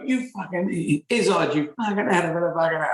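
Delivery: mock street abuser. A heckler jeering at Izzard in the street, mock yob voice. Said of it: "the things like this tend to go".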